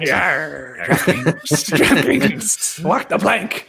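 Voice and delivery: lightly voiced pirate with a low growled yarr. Host answers chat's pirate remark, mock pirate voice. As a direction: play pirate for a joke, drawing words out. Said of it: "saying you sounded like a pirate".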